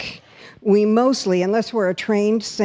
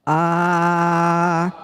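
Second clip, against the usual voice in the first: group throat ah; 2 semitones lower than usual. Shown as a demonstration. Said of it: "let's do it".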